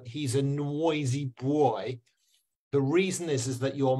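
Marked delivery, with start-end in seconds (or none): 0.0-2.0 slow Myanmar accent, "nwoisy bwoy". Shown as a demonstration. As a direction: add a learner's Myanmar w glide to "noisy boy". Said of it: "he go".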